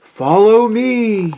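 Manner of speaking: high, slow, drawn-out ghostly call; eerie long-held words; beckoning spirit. A narrator's reading.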